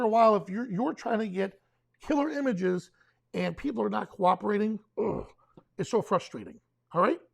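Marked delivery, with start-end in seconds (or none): 5.0-5.3 short exasperated groan; frustrated ugh. Acted. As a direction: grunt out your frustration. Voicing frustration at uncooperative people.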